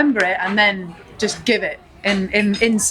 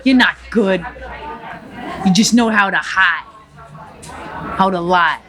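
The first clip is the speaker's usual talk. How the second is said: put-on lightly Cuban-accented gangster rant; defiant Scarface tirade, a hint of accent; Tony Montana. Tony Montana sneering at the restaurant crowd, light Cuban accent. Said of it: "to Tony Montana".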